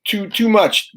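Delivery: ordinary delivery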